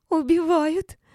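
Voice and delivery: very high, loud, unconvincing shriek; thin squeal. A narrator's reading. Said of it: "заверещала Валеева тоненьким голосом". (верещать тоненьким голосом)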